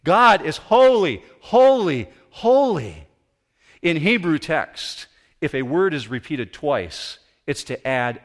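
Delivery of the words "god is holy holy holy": high, loud proclamation; full-voiced call of praise; crying out. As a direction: cry it out in worship, each holy falling away. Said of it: "They cried out to each other constantly".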